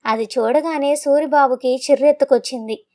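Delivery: plain reading voice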